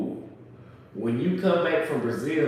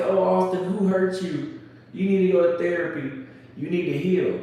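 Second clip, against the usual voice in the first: raised, pushed-up mock concern; mocking imitation; 6 semitones higher than usual. Imitating women who say he needs therapy, mocking.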